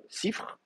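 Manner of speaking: Sifr soufflé, finale en deux consonnes; forme fautive chuchotée. Shown as a demonstration. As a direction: chuchoter Sifr comme mauvais exemple. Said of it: "alors là, ça va pas". (Sifr, soufflé)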